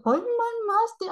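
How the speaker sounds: very high, slow mock anime girl. Vlogger imitating anime girls calling master, high squeal. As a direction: squeal it like a cute anime character. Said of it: "He said".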